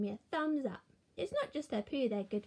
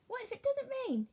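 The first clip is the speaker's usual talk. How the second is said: put-on higher childlike worried question; lightly voiced child; 12 semitones higher than usual. A narrator's reading. Child asks Gran, lightly voiced higher. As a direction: ask like an eager little kid. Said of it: "child voice".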